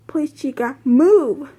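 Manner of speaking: beg, then push out a loud last word; pleading. A narrator's reading. Waitress begging the stalled animatronic to get moving, pleading. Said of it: "the waitress pleaded".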